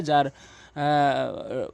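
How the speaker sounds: ordinary talk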